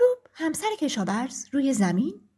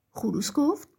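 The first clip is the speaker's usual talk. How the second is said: usual speaking voice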